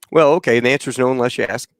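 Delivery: normal talking voice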